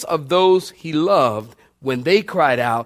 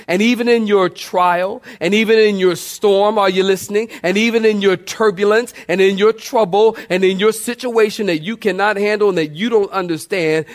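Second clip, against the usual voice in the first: usual speaking voice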